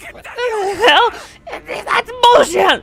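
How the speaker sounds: high, loud, drawn-out outrage; angry protest. Acted. Eliminated candidate yelling at the announcer in outrage. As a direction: yell it in disbelief at the rules.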